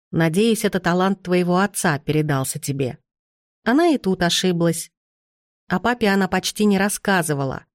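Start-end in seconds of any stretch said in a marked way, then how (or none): none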